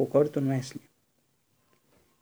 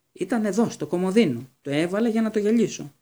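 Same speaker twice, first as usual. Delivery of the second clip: high, indignant raised voice; yelling; 6 semitones higher, 4 dB louder than usual. A narrator's reading. Percy accusing his brother over something missing, yelling. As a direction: complain heatedly, high and pressing. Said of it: "ο Πέρσι ορίωταν".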